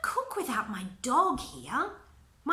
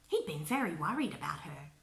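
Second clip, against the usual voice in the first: ordinary delivery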